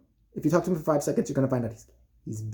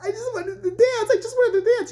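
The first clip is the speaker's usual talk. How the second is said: put-on sad little girls. Young dancers watching parents fight, high mock whimper. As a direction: squeak it like a hammed-up small child.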